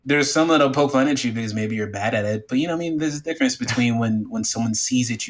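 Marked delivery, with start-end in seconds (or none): none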